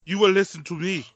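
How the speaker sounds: put-on playful angry African accent, loud and bossy. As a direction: bark it out as a hammed-up angry African. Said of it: "if you need angry African, that's where I come in".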